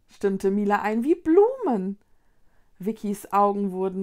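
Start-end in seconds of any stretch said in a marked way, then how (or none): 1.0-2.0 high, loud marvelling; excited wonder. A narrator's reading. Mila marvels at the crystals, excited. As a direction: marvel aloud, pitched well up.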